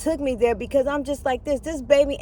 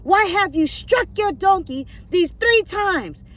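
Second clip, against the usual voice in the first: slow, commanding question, higher; stern angel; 7 semitones higher, 4 dB louder, slower than usual. Acted. The angel confronting Balaam, slow and commanding. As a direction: demand an answer, slow and firm. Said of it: "the angel of the Lord said".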